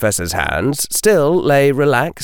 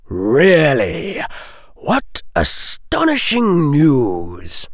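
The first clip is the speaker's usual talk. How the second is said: higher, drawn-out sarcastic wonder; mock astonishment; 6 semitones higher, much slower than usual. A narrator's reading. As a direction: feign amazement to needle him. Mocks the cold denial.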